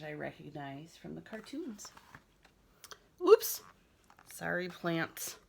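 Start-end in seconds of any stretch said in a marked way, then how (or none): 3.3-3.6 high, loud oops; surprised oops. Acted. Teacher reacting to the dog digging up plants, loud surprised oops. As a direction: yelp it in surprise.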